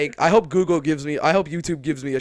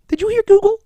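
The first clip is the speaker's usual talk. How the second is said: much higher and louder; excited shout; 11 dB louder, faster than usual. Real, not acted. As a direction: crow about winning. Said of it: "retelling triumph".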